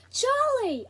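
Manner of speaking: high, loud call across the room; shout. Acted. Boy spots his lost best friend, shouting. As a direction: yell a name across the room. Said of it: "I shouted".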